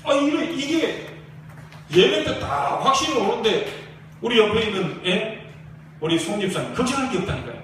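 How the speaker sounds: fiery pastor rallying the congregation; preaching at full force. Acted. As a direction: drive it home to the church like a revival preacher.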